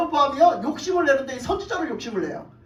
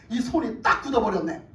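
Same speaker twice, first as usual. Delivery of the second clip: ordinary delivery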